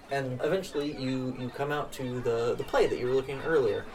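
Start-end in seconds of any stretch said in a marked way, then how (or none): none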